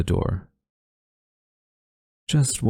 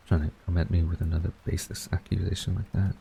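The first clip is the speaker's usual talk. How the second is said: much softer grumble to self; grumbled under breath; 7 dB quieter than usual. Acted. Flustered tutor grumbles at friend's accusation, much softer. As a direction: mutter it half to yourself.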